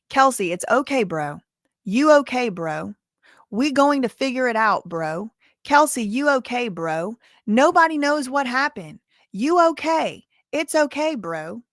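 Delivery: prosecutors' reading. Retold or imitated. Prosecutors reading the statement to the court, mock recital. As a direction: read it in separate punched phrases with falling ends. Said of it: "this is how it was read by the state".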